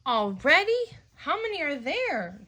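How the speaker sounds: put-upon dad complaining; grumbling. Acted. Daddy Pig grumbling at the batteries, exasperated. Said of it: "grumbles Daddy Pig".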